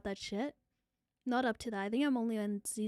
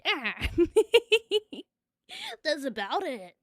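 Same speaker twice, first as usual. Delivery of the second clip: usual speaking voice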